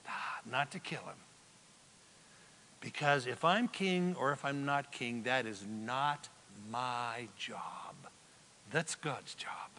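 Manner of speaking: low, very soft, deliberate refusal; hushed refusal. Acted. David turns down Joab in the cave, hushed refusal. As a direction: sink to a near whisper, restrained.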